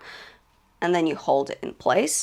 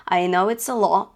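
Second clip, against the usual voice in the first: ordinary talk, nothing marked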